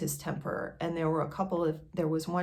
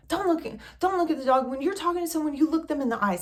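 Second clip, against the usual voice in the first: imitating a furious outburst; 8 semitones higher, faster than usual. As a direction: reenact the friend snapping at her. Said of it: "she spazzes out on me and says".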